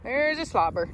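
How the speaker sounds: normal talking voice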